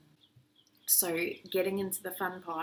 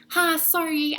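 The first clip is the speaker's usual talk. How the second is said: much higher and louder, bright and pushy; overeager sales greeting; 10 semitones higher, 9 dB louder, slower than usual. Retold or imitated. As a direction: greet like a desperate salesman, pitched way up. Salesperson tries the next person.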